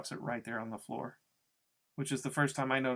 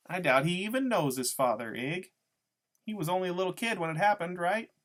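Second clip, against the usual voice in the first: gossipy woman, higher and quick; Loretta; 5 semitones higher, 4 dB louder than usual. A narrator's reading. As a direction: rattle it off to a crony in a raised voice. Narrator voices Loretta chatting to Iggy.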